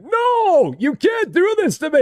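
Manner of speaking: high, loud pleading character voice; Fred's protest. Pretend. Fred the clerk begging not to come back, high whine.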